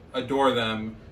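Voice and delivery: usual speaking voice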